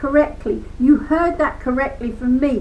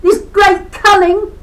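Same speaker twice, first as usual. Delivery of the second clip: loud, high, voice breaking; wailing in despair; anguished cry; 4 semitones higher, 5 dB louder than usual. Real, not acted. Emotional climax.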